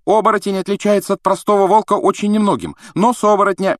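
put-on high, hurried know-it-all girl; eager schoolgirl. A narrator's reading. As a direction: push the answer out eagerly. Hermione answers eagerly.

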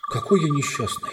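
self-pity. A narrator's reading. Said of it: "думал Шаста".

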